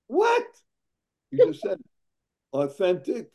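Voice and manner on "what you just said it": high squeaky surprise; shocked. Acted. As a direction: gasp it out, amazed. Mock shocked reaction to pun.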